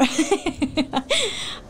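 put-on giggle on cue; performed giggle, a bit breathy and light; deliberate laugh. Shown as a demonstration. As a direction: laugh on purpose for the viewers. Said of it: "I'm gonna laugh more".

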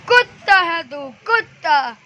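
slow, loud, high dramatic film-villain line; boy performs a film dialogue at his dog, slow and loud; filmy dialogue. Acted. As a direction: deliver the dialogue like a Bollywood hero scolding. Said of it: "आज मैं इसको एक dialogue बोलना चाहता था".

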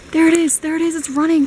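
calling out at the chipmunk, thrilled; excited. Real, not acted. Spotting the running chipmunk.

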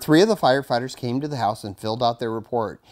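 ordinary delivery, nothing marked

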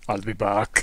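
exaggerated Austrian accent, laughing. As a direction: say it like Arnold Schwarzenegger. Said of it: "Did he say that in an Austrian accent".

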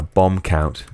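normal talking voice

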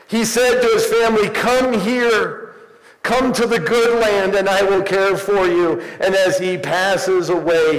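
high, urgent pulpit storytelling; fervent preaching. Real, not acted. Preacher picturing Joseph's family in Egypt, fervent preaching.

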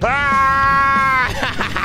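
a high shouted war cry; hammed-up scream; battle scream. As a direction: let rip with a mock attack scream. Flamethrower fired at customers.

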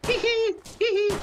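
high squeaky giggling hee hee; Homer Simpson giggle. Retold or imitated. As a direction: giggle like a cartoon dad sneaking off. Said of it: "As the Homer Simpson says".

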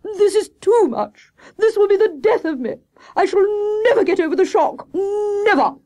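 high, loud, tearful wailing; overwrought, wailing hare voice; melodramatic despair. Acted. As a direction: lament like a tragic ham actor.

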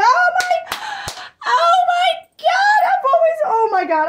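high, loud sobbing squeals of happiness; overwhelmed, tears breaking through the words; crying with joy. Real, not acted. Unboxing baby clothes from a friend, loud happy crying. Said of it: "I'm so happy right now".

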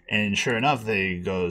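normal talking voice

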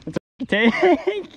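slow, high, laughing through it; delighted laugh over the catch. Real, not acted. Repeated, laughing.

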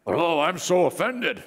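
slow, put-on outrage; sarcastic show of being offended; mock offended. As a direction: act hammily affronted, drawing it out. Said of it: "he looks offended".

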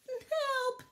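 high calling cry from a pit; cry for help. A narrator's reading. Barley trapped in a pit, calling for help. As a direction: call up for help. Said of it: "once more".

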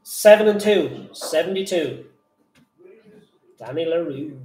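loud sing-song bingo calls; bingo caller. Acted. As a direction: call the numbers like a bingo hall. Bingo number calling.

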